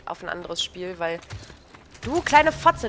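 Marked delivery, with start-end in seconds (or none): 2.1-2.9 sudden furious snap at the screen; angry outburst. Real, not acted. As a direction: blurt it out in rage.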